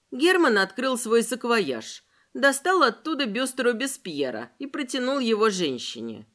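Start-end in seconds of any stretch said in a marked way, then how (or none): none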